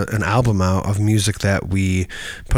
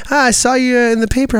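much higher, bright mock greeting; high chirpy greeting; 13 semitones higher, faster than usual. Imitating people greeting him, high and chirpy. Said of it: "my voice goes up".

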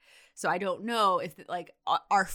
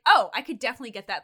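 confident nurse quote; 3 semitones higher, 9 dB louder, much faster than usual. Retold or imitated. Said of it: "He was like".

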